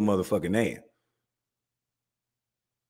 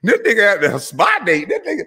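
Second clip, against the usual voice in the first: excited mockery; 12 semitones higher than usual. Real, not acted. Mocking the podcaster's slick haircut.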